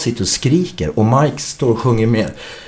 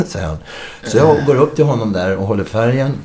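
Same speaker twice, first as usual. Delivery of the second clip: ordinary talk, nothing marked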